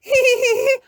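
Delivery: put-on high toddler giggle; a squeaky little laugh. A narrator's reading. George giggles after crash.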